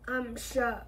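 a hint of weary gloom; gloomy voice. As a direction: say it with resignation. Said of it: "he said in his gloomy voice".

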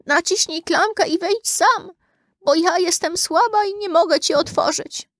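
very high, put-on old granny voice; high character voice for a weak grandmother; frail grandmother. A narrator's reading. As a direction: pitch it way up as the bedridden granny. Weak grandmother answers from her bed.

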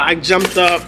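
normal talking voice

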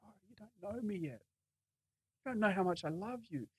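whispered, then very soft, as Jesus answering; hushed reply. Acted. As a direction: whisper it intimately to the doubter. Voicing Jesus answering the doubter.

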